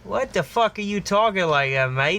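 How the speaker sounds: hammed-up Aussie tough asking what's with the voice; Aussie bloke challenge. Blokes questioning the speaker's fake accent, mock Aussie challenge. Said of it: "they looked at me and they said".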